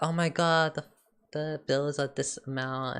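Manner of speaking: lightly mock a complaint about bills; mildly complaining. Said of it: "he's always complaining about".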